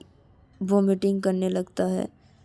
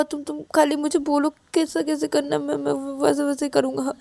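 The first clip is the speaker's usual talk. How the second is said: high, stammering eager pleading; desperate insistence; 5 semitones higher than usual. Acted. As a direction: promise anxiously, stumbling over words. Ravi insists he can do it. (हड़बड़ाकर ऊँचे सुर में भरोसा दिलाना)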